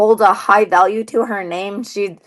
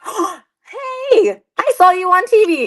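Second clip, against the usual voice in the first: excited; 9 semitones higher, much slower than usual. Retold or imitated. Fan excitedly greeting at arrival.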